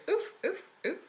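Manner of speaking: soft dog woofs; imitated dreaming dog. Recalling the dog heard on last podcast, soft imitated woofs. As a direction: do a dreaming dog's muffled woofs. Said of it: "Hamish was dreaming, so for the whole thing".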